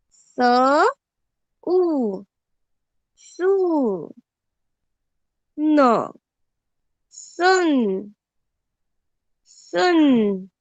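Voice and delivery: सुन said very slowly, then split into स उ न; slow spelled-out reading. Shown as a demonstration. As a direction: stretch सुन out and spell it for beginners. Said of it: "उच्चारण अच्छे से देखो". (सुन को धीरे-धीरे, अक्षर तोड़कर बोलना)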